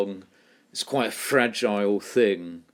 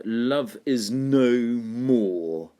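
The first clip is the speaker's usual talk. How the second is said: "no more" both stressed, slow and even; love is no... more, each beat weighted; spondaic stress on no more; 3 semitones higher, much slower than usual. Shown as a demonstration. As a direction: lean equally on "no" and "more". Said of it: "Hear how "no more" are both stressed".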